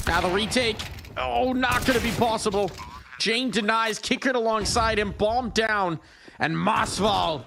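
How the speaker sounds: high, excited esports play-by-play; arena announcer. Real, not acted. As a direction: call the retake like a big moment. Live play-by-play climax.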